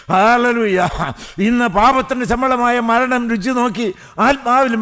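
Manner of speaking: impassioned revival sermon and prayer; fervent preaching. Real, not acted. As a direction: preach it with sustained, urgent fervour. Preaches and prays with fervour. (ആവേശഭരിതമായ സുവിശേഷ പ്രസംഗം)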